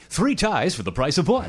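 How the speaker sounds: booming ad announcer read; radio voice. Acted. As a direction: sell it like a radio ad. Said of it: "You like that voice? / Good radio voice".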